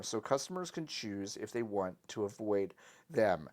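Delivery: ordinary talk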